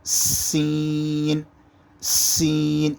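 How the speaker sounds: سین with a sharp s, long ee held level; strong hissed سین. Shown as a demonstration. Teacher demonstrating the letter, سین sharp and drawn out. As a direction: hiss firmly into a stretched سین. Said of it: "سین کی آواز زور سے آئے گی".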